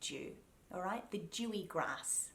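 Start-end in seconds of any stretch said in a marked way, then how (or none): 0.0-0.3 "dew" as joo; "dew" with D and Y fused into J; mushed together. Shown as a demonstration. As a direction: start "dew" on a chewy J. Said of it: "we mush it together and we say".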